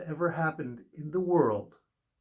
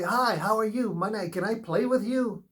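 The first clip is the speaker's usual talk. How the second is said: put-on higher, eager little-boy greeting; little kid greeting; 7 semitones higher than usual. Small boy asking playground kids to play, high and eager. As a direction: run up chirping like a small child. Himself aged four on playground.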